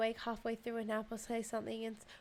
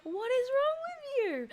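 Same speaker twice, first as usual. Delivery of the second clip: much higher, louder, whining like near tears; mock whiny partner; 6 dB louder than usual. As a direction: play the fed-up partner as a wailing whine. Partner's exasperated reply, retold.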